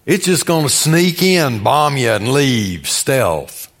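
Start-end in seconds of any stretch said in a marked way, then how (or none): none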